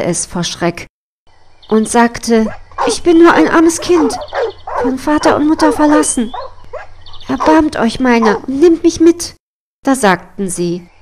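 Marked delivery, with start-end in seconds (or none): 2.9-9.3 put-on pleading girl. Frightened girl begs the hunters.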